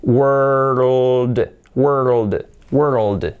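WORLD drawn out so the tongue glides from R to L; deliberate, falling WORLD; slow pronunciation demo. Shown as a demonstration. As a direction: stretch WORLD, then tighten it slightly. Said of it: "Mi lengua va haciendo".

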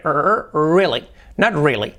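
slow "really" with a strong rolled R; strong R demo. Shown as a demonstration. Teacher shows a strong R in "really". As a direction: lean on the R in "really". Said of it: "y luego la R".

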